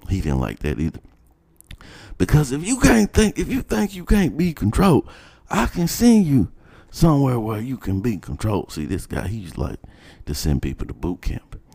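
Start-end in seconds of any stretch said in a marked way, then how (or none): 2.2-8.7 high mock principal voice, threatening; imitating the principal. Principal threatening to send a student away, high mock voice. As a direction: squeeze it up high like a smug administrator.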